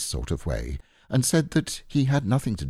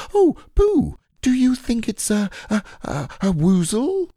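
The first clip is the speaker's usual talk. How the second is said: high, loud, breathless squeak; excited Piglet; 14 semitones higher, 5 dB louder than usual. A narrator's reading. Piglet asking Pooh about woozles, squealing excitedly. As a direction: squeal it, half scared, half thrilled. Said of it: "He gave a little squeak of excitement".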